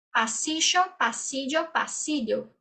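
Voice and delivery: "pasillo" with sh, then j, then lh; three ll sounds. Shown as a demonstration. Teacher running students through three "pasillo"s. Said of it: "três formas de pronunciá-las".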